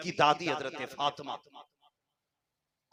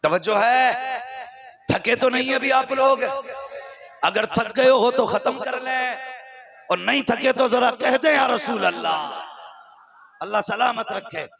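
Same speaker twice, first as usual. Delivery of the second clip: high, loud call to the audience; ringing preacher's challenge; rousing the crowd; 8 semitones higher, 9 dB louder than usual. Real, not acted. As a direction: demand a response from the congregation.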